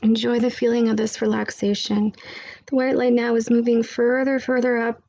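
guided meditation. Acted. Leads a meditation.

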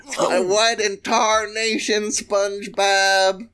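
hammed-up Sandy Cheeks scolding SpongeBob; high drawn-out Texan exclamation; Sandy Cheeks impression. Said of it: "Now here's a good Sandy".

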